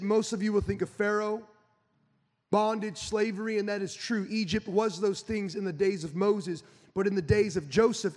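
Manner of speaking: usual speaking voice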